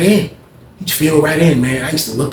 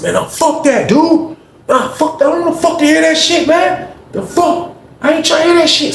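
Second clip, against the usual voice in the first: overheard angry yelling. Retold or imitated. Someone yelling in the cell about the la la, furious shout. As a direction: scream it like you're fed up.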